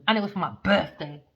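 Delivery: ordinary talk, nothing marked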